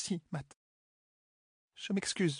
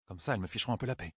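much lower and softer aside; aside under her breath; 8 semitones lower, 7 dB quieter, faster than usual. Acted. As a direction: mutter it just for Matt. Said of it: "À voix basse". (à voix basse, en aparté)

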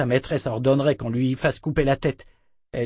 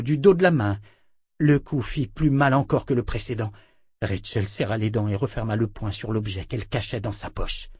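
usual speaking voice

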